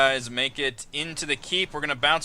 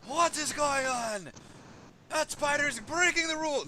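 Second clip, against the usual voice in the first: high, slow indignant voice; Gerudo woman. Acted. Gerudo woman protesting the spider, high slow indignant voice. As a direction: put on a high, drawn-out outraged woman. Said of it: "the Gerudo woman just say".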